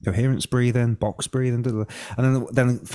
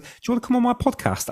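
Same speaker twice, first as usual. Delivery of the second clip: much higher, bright hopeful pitch; eager ask; 13 semitones higher, faster than usual. Retold or imitated. Asking James Nestor onto his podcast, eager high pitch. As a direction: pitch the invite like a hopeful newcomer. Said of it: "So I wrote out and said".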